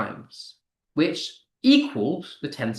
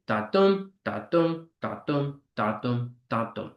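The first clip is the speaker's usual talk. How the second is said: light "da", strong "dum", trailing softer with each pair; iambic beat; 3 semitones lower, 5 dB quieter than usual. Shown as a demonstration. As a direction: chant "da dum" with the weight on "dum". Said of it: "unstressed followed by stressed five times".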